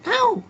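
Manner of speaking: high squealed ouch; mock yelp. Kid squealing over nothing. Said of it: "mock yelp".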